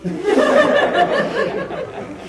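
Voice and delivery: high burst of laughter; loud laugh. Real, not acted. Laughing at memory.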